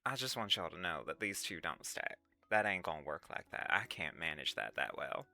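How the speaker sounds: put-on low, subdued Southern accent; emo Southern. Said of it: "Emo Southern?".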